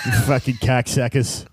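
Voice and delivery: put-on low, grumbling Boston accent. Comic as a Bostonian cursing the others, low mock Boston accent. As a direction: curse them like a sour Bostonian, accent and all. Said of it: "the Boston people be like".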